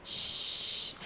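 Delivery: soft, long shush; quiet calming "shhh". Shown as a demonstration. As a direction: hush the room gently. Demonstrates hushing to calm a noisy crowd.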